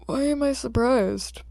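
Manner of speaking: put-on detective tired of the same kid; weary detective. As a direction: let the sigh show in the question. Tired detective sees Izuku again.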